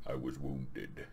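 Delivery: low, hushed, very slow villain; soft, deep mock-solemn tyrant; Doctor Doom's voice. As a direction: confess it gravely, drawn out. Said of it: "This is how they're talking".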